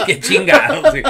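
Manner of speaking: words squeezed out through high laughter; can't stop laughing while talking. Real, not acted. Laughing at the rejected shirt. (riendo a carcajadas)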